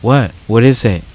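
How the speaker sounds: a touch of alarm, narrated; startled. A narrator's reading. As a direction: react as if jolted by a shout. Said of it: "She jumped and startled".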